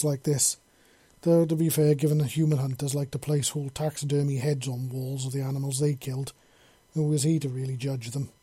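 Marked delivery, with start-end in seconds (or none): none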